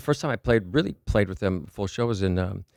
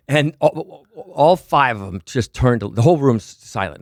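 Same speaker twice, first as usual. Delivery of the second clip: normal talking voice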